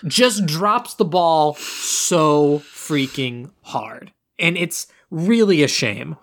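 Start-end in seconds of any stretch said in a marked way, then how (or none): 0.1-4.1 drawn out. Real, not acted. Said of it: "emphatic complaint".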